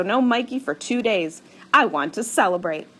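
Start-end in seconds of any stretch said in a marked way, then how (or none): none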